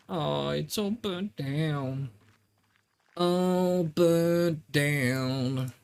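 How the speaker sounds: very slow, stretched "all burnt down"; drawn-out lament. Pretend. Streamer hamming up the abbey fire line, very slow. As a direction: draw out each word like a mock dirge.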